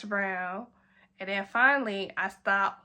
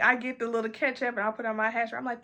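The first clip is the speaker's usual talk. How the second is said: ordinary talk, nothing marked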